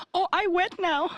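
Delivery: high squeaky mock accented lady voice. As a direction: camp it up as the winking waitress.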